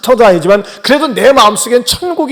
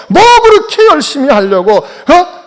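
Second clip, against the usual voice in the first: higher sneering mock question; mocking jibe; 6 semitones higher, 3 dB louder than usual. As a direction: needle the zealous believer. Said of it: "그러냐고 하면서 말이죠". (열심인 신자를 비꼬듯 높은 목소리로 놀리는 말투)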